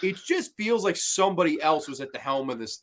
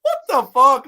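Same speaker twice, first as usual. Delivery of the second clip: mock shocked outburst; 8 semitones higher, 9 dB louder than usual. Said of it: "I'm like".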